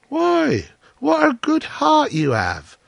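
very high-pitched gushing wife; admiring cry. A narrator's reading. Wife praising the miller's good heart, gushing. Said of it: "cried his wife".

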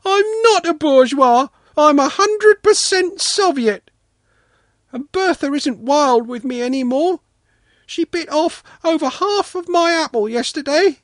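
put-on little boy's high, indignant wailing complaint; offended wail. A narrator's reading. Sanka denying he's a bourgeois, wailing in hurt protest. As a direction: pour out grievances in a high, loud child's voice. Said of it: "Sanka wailed, deeply offended".

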